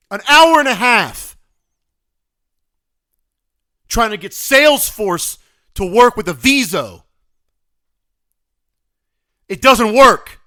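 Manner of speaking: loud, high-pitched angry rant; exasperated yelling. Real, not acted. Complaining about an hour and a half lost to software, exasperated yelling. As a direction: shout in outraged frustration.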